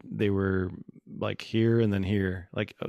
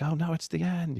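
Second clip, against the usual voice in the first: higher-pitched mock panic; mock alarm; 9 semitones higher than usual. Imitating people who fear the end, mock alarm. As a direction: act out a doomsayer's dismay. Imitating doomsday believers' reaction.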